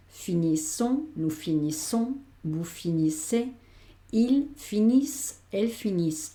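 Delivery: finissent said finiss, silent E N T, slowly; slow French conjugation. Shown as a demonstration. Teacher slowly models plural forms: finissent without the ENT. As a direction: drag out finissons and finissez, finissent ending on the S sound. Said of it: "esta E N T, aunque la escribo, no la pronuncio".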